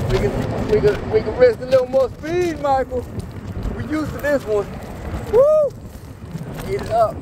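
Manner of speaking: excited. Real, not acted. Excited riding, speeding up.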